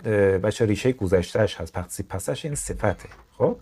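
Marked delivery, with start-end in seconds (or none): none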